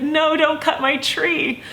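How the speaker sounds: high, slow tearful wail; retold plea that really chokes her up; bawling child. Vlogger as her child self begging for her tree, bawling. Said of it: "just bawling".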